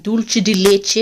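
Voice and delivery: dulce de leche in a put-on accent, ending "CHEH"; put-on foreign accent. Real, not acted. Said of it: "I don't know how to say it otherwise".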